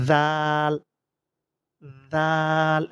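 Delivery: জাল as Arabic dhal, voiced th and long level aa; tongue-tip dh. Shown as a demonstration. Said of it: "এইভাবে উচ্চারণ করতে হবে". (জিহ্বার মাথা থেকে জাল টেনে)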